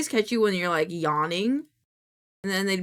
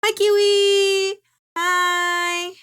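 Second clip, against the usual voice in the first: much higher, louder, slow delighted hello; excited pet greeting; 10 semitones higher, 7 dB louder, much slower than usual. Real, not acted. Streamer greeting her pet Kiwi, excited high hello. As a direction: greet the pet with big joy.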